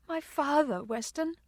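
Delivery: high, fragile grief; soft crying. A narrator's reading. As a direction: break the bad news softly, tearfully. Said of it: "she cried softly".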